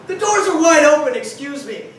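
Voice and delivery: loud, high indignant protest; bursting out to defend ourselves; indignant outburst. Retold or imitated. To the cops, a loud indignant protest.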